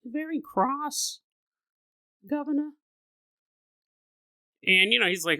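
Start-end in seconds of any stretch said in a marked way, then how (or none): 0.0-2.7 put-on high, slow child's voice; a small girl sounding proper; mock-British child; little British girl. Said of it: "is the word she used".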